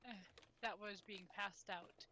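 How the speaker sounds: ordinary talk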